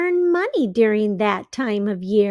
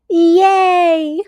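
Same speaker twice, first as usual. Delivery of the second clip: loud, higher cheer; 7 semitones higher, 8 dB louder than usual. Acted. Pete cheering that he saved enough, loud cheer. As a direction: cheer it like you won. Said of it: "Pete cheers".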